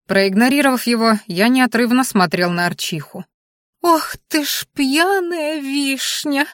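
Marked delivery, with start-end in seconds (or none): 3.8-6.5 high, slow squealing cry. A narrator's reading. Anfisa shocked at the news, high squeal. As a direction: shriek it out in shock. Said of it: "взвизгнула Анфиса".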